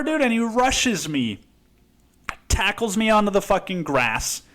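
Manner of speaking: normal talking voice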